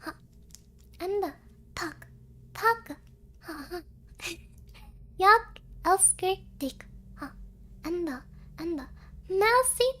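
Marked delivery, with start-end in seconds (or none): none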